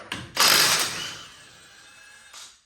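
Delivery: harsh coughing fit, choking on spit. Real, not acted. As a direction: cough hard like spit went down wrong. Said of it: "Choking on my own spit".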